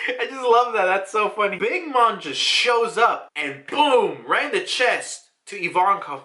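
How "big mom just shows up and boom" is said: ordinary talk, nothing marked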